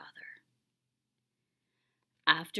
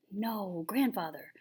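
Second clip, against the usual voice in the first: plain reading voice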